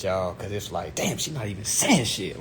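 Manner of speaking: usual speaking voice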